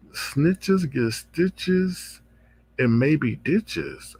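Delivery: each word drawn out very slowly; slow quoted threat. Retold or imitated. Host quoting Lady Nika's threat to Ken, very slow. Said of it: "she threw a subliminal and said".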